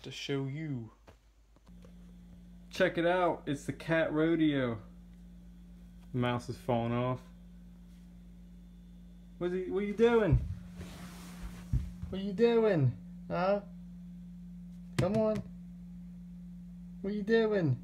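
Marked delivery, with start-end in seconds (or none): 6.1-17.8 higher-pitched coaxing pet talk. Real, not acted. Owner asking the cat what it's doing, coaxing.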